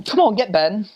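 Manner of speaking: high-pitched mock Scottish call; imitating a Scottish host; Scottish accent. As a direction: beckon someone in with Scottish brogue. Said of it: "or they say".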